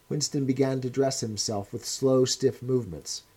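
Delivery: plain reading voice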